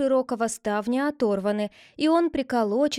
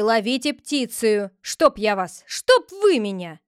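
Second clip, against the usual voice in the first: louder, higher furious ranting; 3 semitones higher, 6 dB louder than usual. Acted. The head rages at the disobedient villagers, furious. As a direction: rage at them.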